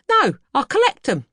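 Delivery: high, loud and chirpy sweep; cheerful sweep. Acted. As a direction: toss off the answer brightly. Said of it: "said the sweep".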